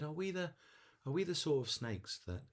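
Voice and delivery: high little-snake voice, a touch anxious; worried little snake. Acted. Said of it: "worried, frightened, anxious".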